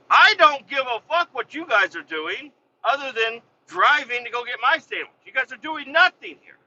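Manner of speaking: high, exasperated mad rant; retold angry outburst. Boss telling off workers over his sandwich, angry. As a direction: tear into idle workers, pitch jumped way up. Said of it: "I got mad".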